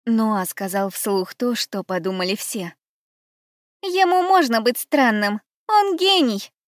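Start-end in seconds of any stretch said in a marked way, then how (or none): 3.8-6.5 high, louder, eager defending; defensive. Acted. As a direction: rush to defend him. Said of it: "бросилась защищать преподавателя".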